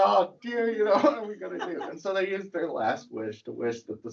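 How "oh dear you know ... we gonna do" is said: higher, fast, laughing "what are we gonna do"; playfully panicked couple; mock dismay.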